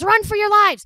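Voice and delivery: high, loud warning yell; panicked shout. A narrator's reading. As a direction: scream it out to clear the office. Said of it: "So he shouts".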